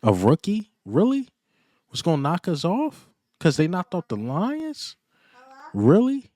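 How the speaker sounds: high, loud mocking disbelief; incredulous. Real, not acted. Scoffing at rival fans' hopes.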